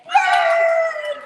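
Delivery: loud high woo; excited cheer. Real, not acted. Teacher cheering the class loud and high.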